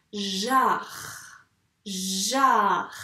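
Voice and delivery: "jar" drawn out, swelling on the a; long j, open a, trailing French r in "jar"; dictated syllable. Shown as a demonstration. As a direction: sound out "jar" slowly, holding j, a, r. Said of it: "J'aimerais bien que tu m'écrives la syllabe".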